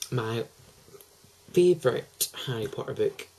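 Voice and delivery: normal talking voice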